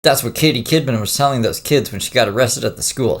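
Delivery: ordinary talk, nothing marked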